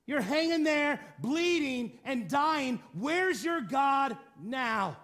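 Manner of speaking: mocking scoff. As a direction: scoff at them, slowing to drawn-out final words. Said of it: "this is where the, the people mocked. This is where they scoffed".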